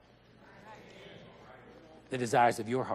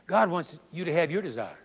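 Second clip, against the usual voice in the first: usual speaking voice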